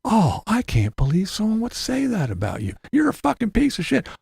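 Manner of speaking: high mock-shocked concern; mock fake sympathy. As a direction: play fake outrage on a friend's behalf, pitched high. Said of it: "It was him the whole time".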